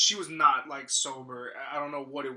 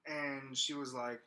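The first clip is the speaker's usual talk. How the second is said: usual speaking voice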